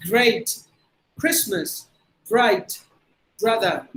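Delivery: "Great", "Christmas", "Bright", "Brother" with smooth American R; deliberate single words, tongue never touching on "Brother"; untapped R. Shown as a demonstration. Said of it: "So that's how you have to practice it". ("Great", "Brother" நாக்கு படாத அமெரிக்க R)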